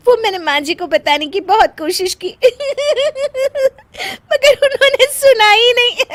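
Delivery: giggles between the words; wife explaining to husband, giggling; laughing. Acted. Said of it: "तुम तो फिर से हंस दी".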